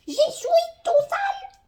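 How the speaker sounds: hammed-up toddler wail, drawn out; mock crying whine. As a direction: pretend to cry like a toddler. Voicing her own mess childishly.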